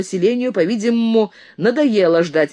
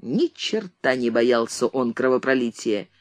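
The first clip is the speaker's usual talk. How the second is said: plain reading voice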